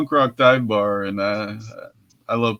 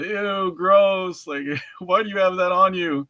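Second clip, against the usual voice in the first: put-on high, disgusted kid voices; mocking kids; 6 semitones higher than usual. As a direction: imitate grossed-out kids. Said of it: "all the kids started pointing at me, going".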